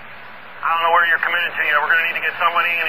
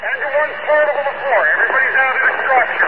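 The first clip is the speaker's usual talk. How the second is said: raised, urgent radio report; urgent radio call; 7 semitones higher than usual. Real, not acted. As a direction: push it out over the radio noise.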